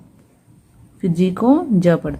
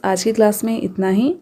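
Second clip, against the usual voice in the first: ordinary talk, nothing marked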